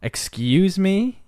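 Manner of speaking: high, slow, offended challenge; slow and affronted; indignant. Retold or imitated. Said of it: "And more of".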